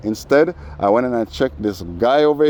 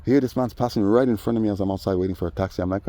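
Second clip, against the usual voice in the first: ordinary talk, nothing marked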